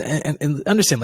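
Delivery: ordinary talk, nothing marked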